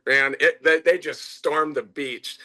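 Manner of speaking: ordinary delivery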